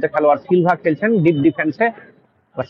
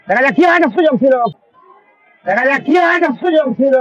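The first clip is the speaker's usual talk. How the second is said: excited commentary; 8 semitones higher than usual. Real, not acted. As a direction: shout it up as the chance opens. Near chance in live match commentary.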